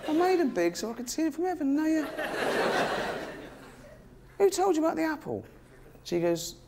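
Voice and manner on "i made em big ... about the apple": very high, indignant squawk; hammed-up divine outrage, pitched way up; mock furious God. God questions the pair in Eden, mock furious. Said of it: "He's furious".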